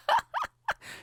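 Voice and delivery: very high-pitched laugh; helpless falsetto laugh; high giggle. Real, not acted. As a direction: burst into a squeaky giggle at yourself. Laughs at his own nonsense.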